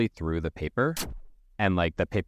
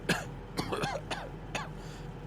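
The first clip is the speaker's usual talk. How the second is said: thin breathy hacking cough; mock cough; 9 dB quieter than usual. Cheryl on the ground, lightly faked cough. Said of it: "you hear some, like, coughing".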